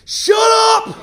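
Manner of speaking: the substitute finally losing it; teacher snapping. Retold or imitated. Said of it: "She said".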